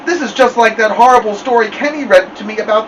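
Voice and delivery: high, tearful little-brother voice; blubbering. A narrator's reading. Crying Joey recalls a horrible story, blubbering. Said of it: "Joey blubbered out".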